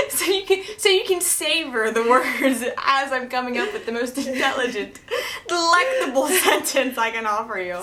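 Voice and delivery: laughing. Real, not acted. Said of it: "I say the words really slowly".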